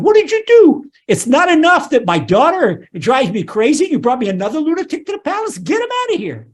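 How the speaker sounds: high-pitched, hammed-up outrage; exasperated king. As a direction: act a fed-up king scolding his lieutenants. Acting out exasperated king to lieutenants.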